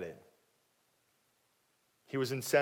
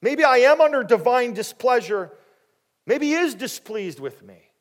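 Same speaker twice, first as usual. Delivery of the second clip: loud, high, anguished acted thoughts; tormented self-doubt; 9 semitones higher, 8 dB louder than usual. Hannah's taunting thoughts about God's curse, tormented. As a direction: voice the nagging fear, pitched high. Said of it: "constantly taunted by her thoughts".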